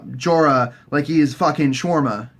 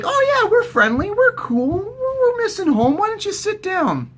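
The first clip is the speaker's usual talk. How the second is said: friendly soldiers. Retold or imitated. Lannister soldiers inviting Arya to sit, high mock-friendly voice. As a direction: put on a high, welcoming soldier voice. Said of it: "they're all like".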